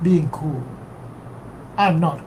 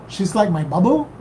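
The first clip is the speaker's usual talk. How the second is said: ordinary delivery, nothing marked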